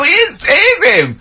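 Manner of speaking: high, excited mock squeal; excited onlooker. Bystanders recognise Frog Boy leaping up, high excited squeal. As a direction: gasp it out like a starstruck fan. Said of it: "And they go".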